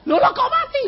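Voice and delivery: high, startled protest; shocked caller. Retold or imitated. Shocked caller protests to the pastor: high startled voice. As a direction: react in surprise at dying.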